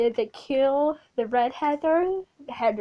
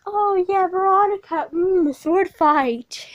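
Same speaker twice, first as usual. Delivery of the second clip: higher, louder dumb-jock taunt, slow at the end; leering jocks; 7 semitones higher, 7 dB louder than usual. Retold or imitated. Football guys teasing Veronica at school, higher, louder leering taunt. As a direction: leer like a crude football guy. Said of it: "they were like".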